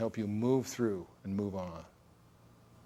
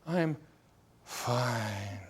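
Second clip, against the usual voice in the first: sighing. Retold or imitated. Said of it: "They're sighing".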